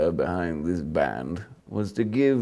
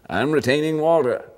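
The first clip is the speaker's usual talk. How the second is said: mock exclaimed saying; 8 semitones higher, 6 dB louder than usual. Punchline quote of old saying.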